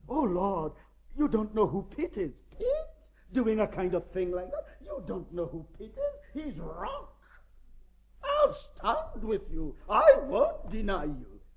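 high, loud, cocky swagger, mocking Peter's boast; boastful Peter. Peter boasting loudly to the Lord that he won't deny him.

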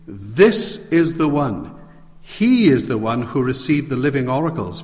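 preacher stressing This Moses, this man; emphatic stress on This. Shown as a demonstration. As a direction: lean on each This with a pause after it. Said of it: "I can emphasize it like this".

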